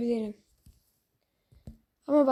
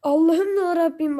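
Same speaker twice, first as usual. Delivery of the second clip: louder, higher despairing cry; exasperated cry; 5 semitones higher, 6 dB louder than usual. Real, not acted. Gamer cries out at his teammates in loud exasperation. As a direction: howl it at the heavens in frustration. Exasperated outburst mid-match.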